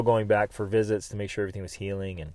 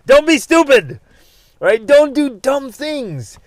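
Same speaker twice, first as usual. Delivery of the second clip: loud, high, blunt warning; 12 dB louder than usual. Real, not acted. Urging listeners to avoid stupidity, loud blunt warning. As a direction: hammer home the warning. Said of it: "If I can say it bluntly".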